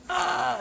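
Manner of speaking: high-pitched "ah" of pain; mock pained yelp. Cloak bitten by Shriek, high mock yelp. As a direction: cry out as if just bitten. Voices bitten character crying out.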